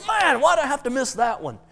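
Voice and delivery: high-pitched comic whine; playful put-out grumble; mock disappointment. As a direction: moan about missing out, hammed up. [name] complains at missing out.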